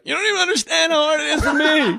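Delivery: high hammed-up yell through a laugh; mock outburst. Boyfriend to his girlfriend, hammed-up high whiny yell. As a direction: shout it like a wounded, overwrought boyfriend.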